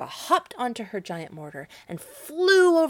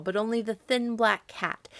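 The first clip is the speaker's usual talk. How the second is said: usual speaking voice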